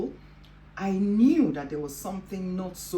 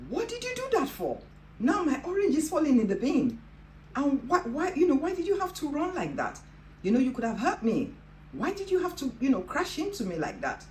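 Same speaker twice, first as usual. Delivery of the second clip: high, indignant angry questioning with the pitch soaring; angry outburst; 9 semitones higher than usual. Retold or imitated. Teenage girl scolding the student who crashed into her, angry and high. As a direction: scold the girl who knocked your orange into the bin. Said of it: "I was angry, I was annoyed".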